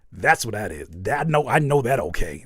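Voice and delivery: ordinary talk, nothing marked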